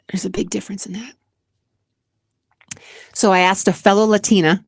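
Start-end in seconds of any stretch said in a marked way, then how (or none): 0.1-1.1 fast, whispered repeat. Real, not acted. Vlogger whispering the point again to her viewers.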